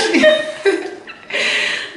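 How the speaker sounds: breathy high-pitched giggle; high laugh. Real, not acted. Laughing after crab joke.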